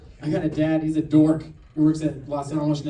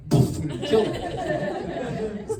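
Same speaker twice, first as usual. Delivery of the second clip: ordinary delivery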